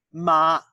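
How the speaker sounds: Ma held high and level, loud; first tone Ma. Shown as a demonstration. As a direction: sing Ma on one steady high note. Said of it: "Sung that way".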